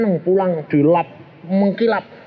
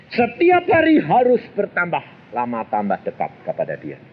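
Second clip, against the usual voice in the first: ordinary delivery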